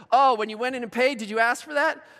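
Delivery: high, loud careless mechanic voice; mock-cheerful mechanic, loud and high; breezy mechanic. Jiffy Lube worker deflecting.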